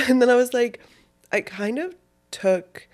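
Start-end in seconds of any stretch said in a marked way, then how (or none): 0.0-0.8 laughing burst, louder. Real, not acted. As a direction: laugh through the words. Laughs mid story.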